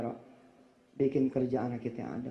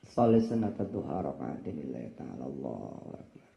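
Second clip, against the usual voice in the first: low soft prayer intention; prayer takbir; 13 semitones lower, 4 dB quieter than usual. Acted. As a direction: begin prayer, low and hushed. (niat sholat dengan suara rendah dan pelan)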